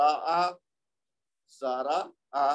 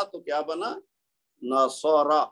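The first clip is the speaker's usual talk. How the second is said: normal talking voice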